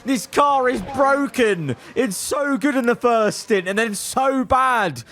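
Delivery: high, slow frustrated outcry; high, drawn-out exasperation at the car; frustrated outburst. Real, not acted. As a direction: vent at the broken car.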